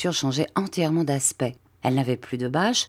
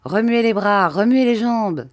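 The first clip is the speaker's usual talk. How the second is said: loud, higher calls to move; Bob shouting; 6 semitones higher, 5 dB louder than usual. Acted. Bob shouts to the fallen boy to move, loud and higher. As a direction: shout the orders up at him, louder and higher. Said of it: "cria Bob".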